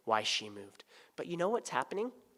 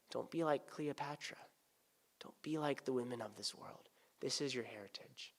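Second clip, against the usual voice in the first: whispered warning; 9 dB quieter than usual. Acted.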